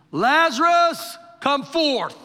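high, loud call with stretched name; booming preacher's imitation of a summoning cry; retold shout. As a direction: shout it out as a command to the dead. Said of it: "then he shouts and says".

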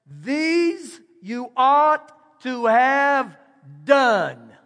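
very slow, stretched "These you ought to have done."; drawn-out emphasis. Shown as a demonstration. Preacher reading the verse to his congregation, very slow and stretched. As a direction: drag each word, then drop hard on "done.". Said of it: "notice that word there".

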